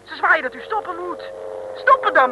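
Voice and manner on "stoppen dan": urgent call. Acted. Passenger urges the driver to stop.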